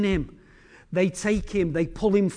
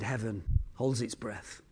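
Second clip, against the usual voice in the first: hushed refrain; 10 semitones lower, 10 dB quieter than usual. A narrator's reading. Repeated line, hushed.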